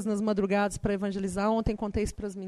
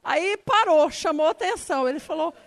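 normal talking voice

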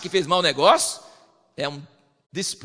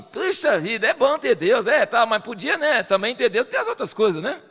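grudging, higher mock praise; half-hearted gratitude; 6 semitones higher, 5 dB louder than usual. Preacher imitates a lukewarm believer, grudging and higher. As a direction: sound only half glad about God. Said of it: "E ele não fala assim".

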